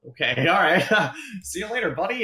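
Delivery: high-pitched, nervous brush-off; hurried goodbye as a backed-down photographer; retreating cameraman. Acted. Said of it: "the cameraman says".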